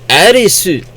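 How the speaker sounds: "Arisu" high and loud, tapped R; Japanese-style pronunciation. Retold or imitated. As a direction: say "Arisu" as a Japanese speaker, SU ending. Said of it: "they would go like".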